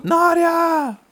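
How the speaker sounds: very high mock cry of a name; dramatic call. Walter bursting in through the door, dramatic call. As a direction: imitate a lover bursting in and calling out. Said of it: "he opens the door and he goes".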